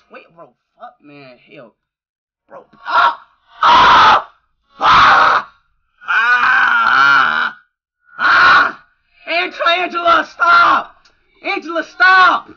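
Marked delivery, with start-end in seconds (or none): 2.5-8.8 loud panicked screaming; screaming in real fright; terrified screams. Real, not acted. Jump scare again. 9.3-12.5 higher, loud, slow pleading yell; desperate shouting. Real, not acted. Begging the game character.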